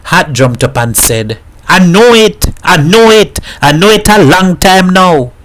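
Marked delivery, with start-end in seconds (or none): 1.7-5.3 loud, high triumphant outburst; excited. A narrator's reading. Hat jumps up to tell the boys, loud and triumphant. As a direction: burst out with the discovery.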